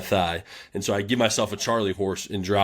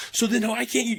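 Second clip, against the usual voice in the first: high, pitched-up imitation of a furious coach; angry coach rant. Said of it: "he was pissed off at the team".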